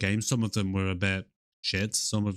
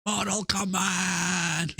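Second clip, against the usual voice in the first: slow, drawn-out higher "Mortal Kombat!"; film announcer shout; 8 semitones higher, much slower than usual. Retold or imitated. Hyping the opening credits, slow drawn-out shout. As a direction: stretch it like the movie's hype call.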